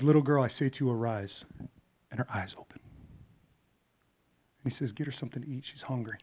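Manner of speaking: soft, low, tender; gentle hushed voice. Acted. Jesus at the girl's bedside, soft and tender. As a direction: speak quietly as if at a bedside. Jesus raising the girl, softly.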